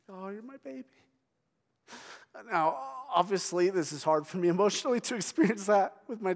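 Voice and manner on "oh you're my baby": put-on high, soft baby talk; cooing to a baby. Parent to a baby, soft high cooing. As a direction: coo it like to an infant. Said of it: "like you would say it about your baby".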